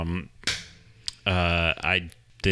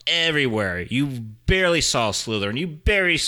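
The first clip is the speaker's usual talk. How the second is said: high, loud and worked up; excited; 6 semitones higher, 3 dB louder than usual. Real, not acted. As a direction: rant it with rising excitement. Excited rant about Hufflepuffs.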